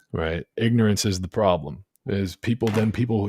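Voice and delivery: usual speaking voice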